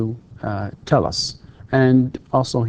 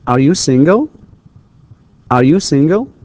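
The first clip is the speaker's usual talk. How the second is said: loud "Are you single" climbing at the end; rising intonation; 3 semitones higher, 6 dB louder than usual. Shown as a demonstration. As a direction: lift "single" up into a question. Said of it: "the intonation is rising".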